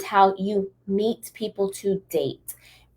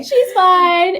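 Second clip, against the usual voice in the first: tipsy reassurance; 11 semitones higher, 6 dB louder than usual. Retold or imitated. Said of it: "we were like".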